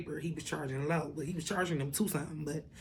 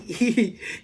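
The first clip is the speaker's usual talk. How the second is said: short loud burst of laughter; 5 semitones higher, 9 dB louder than usual. Real, not acted. Laughs at friend's readiness.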